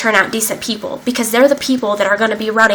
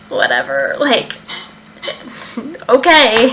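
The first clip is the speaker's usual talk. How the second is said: mock-bored teen shrugging off advice; dismissive; slower than usual. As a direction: brush them off like you couldn't care less. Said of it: "And I was just like".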